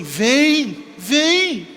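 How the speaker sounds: loud, high, summoning call; voice from the dream. Retold or imitated. Voice calls the frightened woman to the light, summoning call. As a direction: beckon someone across from afar. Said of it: "escutou uma voz dizendo assim".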